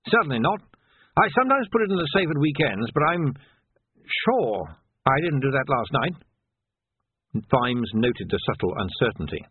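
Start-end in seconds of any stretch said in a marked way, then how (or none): 0.1-6.1 put-on higher-pitched, indignant old officer; an ageing captain insisting, pitched up; flustered old captain. Old captain denying it to his sergeant, flustered.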